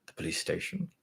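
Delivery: breathy laugh through the words; Rosemary laughing at the girl's fear. A narrator's reading. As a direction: laugh off the idea. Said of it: "Rosemary laughed out".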